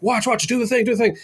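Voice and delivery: eager. Retold or imitated. Responder eagerly urging actor.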